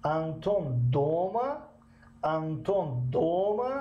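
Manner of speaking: slow, دوما rising into a question; teacher giving an example of the location question; question rise. Shown as a demonstration. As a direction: draw دوما upward to ask where Anton is. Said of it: "مثلاً".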